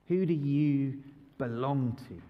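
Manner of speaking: ordinary talk, nothing marked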